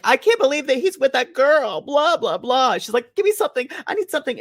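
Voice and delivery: high whiny pleading; mock whiny girlfriend. Friend recaps a movie, mocking the girlfriend's whiny begging. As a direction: whine it high like a spoiled girl.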